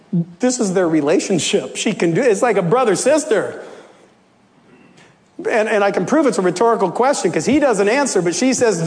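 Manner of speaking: ordinary talk, nothing marked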